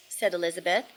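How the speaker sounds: plain reading voice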